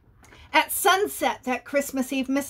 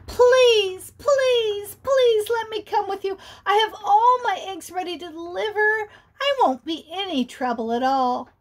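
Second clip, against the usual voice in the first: high, louder begging bunny; small bunny pleading, much higher; 6 semitones higher, 4 dB louder than usual. Acted. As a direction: beg Santa desperately, high.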